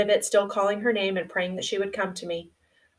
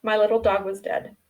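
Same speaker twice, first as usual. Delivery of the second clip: ordinary delivery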